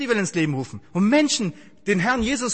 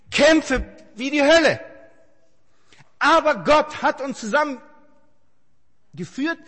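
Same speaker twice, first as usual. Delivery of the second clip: forceful preaching; 8 semitones higher, 6 dB louder, much slower than usual. Real, not acted. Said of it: "preaching climax".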